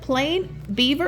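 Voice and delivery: usual speaking voice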